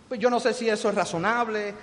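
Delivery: ordinary talk, nothing marked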